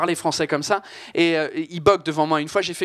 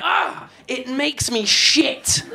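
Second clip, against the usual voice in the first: slow exaggerated English grumble, higher; mock annoyed English; 6 semitones higher, much slower than usual. Shown as a demonstration. Comedian translating the French gripe, mock fed-up. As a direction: mangle the phrase in English. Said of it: "en anglais... ça donnerait".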